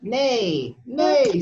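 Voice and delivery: drawn-out spoken neigh; horse's neigh. A narrator's reading. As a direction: neigh like a horse for little kids. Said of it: "said the horse".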